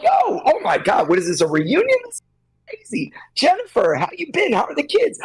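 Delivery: high, gushing catch-up voice; squealing hello at a surprise reunion; mock excited reunion. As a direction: play an overjoyed old friend bumping into people. Acting out a chatty reunion.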